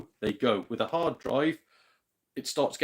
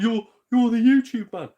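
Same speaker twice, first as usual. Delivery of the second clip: high, loud starstruck fan voice; 10 semitones higher, 9 dB louder than usual. Retold or imitated. Fan in a shop recognising a YouTuber, gushing. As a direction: gush it like a fan spotting a celebrity. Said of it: "they're like".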